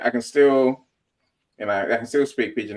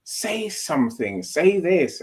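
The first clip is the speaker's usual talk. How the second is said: slow start, rising higher on the second push; urging inner voice; 7 semitones higher, slower than usual. Retold or imitated. Inner voice pushing the speaker to speak up, urging. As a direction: prod insistently, pitch climbing.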